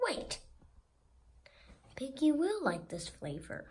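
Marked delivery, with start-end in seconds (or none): none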